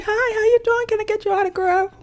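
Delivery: high, soft, quick imitation of an eager fan. Eager New York fans asking Queen for an autograph, high soft mimicry. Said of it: "The people from New York kept walking up to me".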